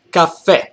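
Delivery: "caffè" with a loud, punched final FÈ; stress on last syllable. Shown as a demonstration. Teacher showing Italian word stress, hitting the FÈ of "caffè". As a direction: land hard on the end of "caffè". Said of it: "and the last syllable".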